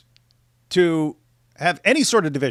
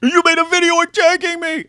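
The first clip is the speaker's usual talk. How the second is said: mock whine; 13 semitones higher, 7 dB louder than usual. Mocking Andrew's complaint.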